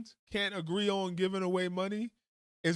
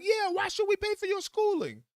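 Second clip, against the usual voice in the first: much higher, mock gullible voice; a hammed-up gullible voter repeating the pitch; dopey agreeing voter; 13 semitones higher than usual. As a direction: play the easily swayed citizen nodding along. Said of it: "And they'll be like".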